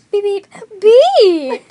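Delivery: loud beeps. Shown as a demonstration.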